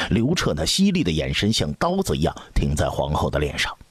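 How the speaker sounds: usual speaking voice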